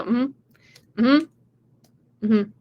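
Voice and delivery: dismissive mm-hmm. Pretend. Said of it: "just start tuning them out and just go".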